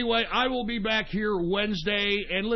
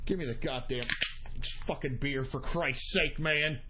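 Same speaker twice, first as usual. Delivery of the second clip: softer, lower grumbling after the rant; calming down; 8 semitones lower, 7 dB quieter than usual. Real, not acted. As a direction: mutter it, trying to cool off. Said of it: "So, I'm gonna calm down".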